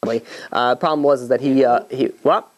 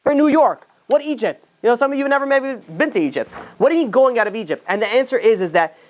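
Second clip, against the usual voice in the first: raised, ringing sermon voice; preaching; 8 semitones higher than usual. Acted. Rabbi to the congregation about Egypt, preaching high and full. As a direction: lift the question to the whole room.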